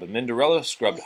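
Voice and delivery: usual speaking voice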